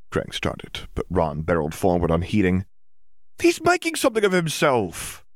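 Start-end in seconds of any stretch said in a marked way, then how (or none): 3.4-5.2 high, defensive sibling argument; heated. Acted. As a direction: defend him hotly. Ron defends Percy heatedly.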